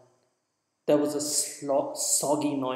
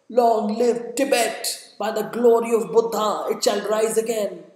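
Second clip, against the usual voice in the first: defiant loud cry; 8 semitones higher, 6 dB louder than usual. Acted. Wounded monk defying the governor, crying out loudly and proudly. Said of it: "cried in a loud voice".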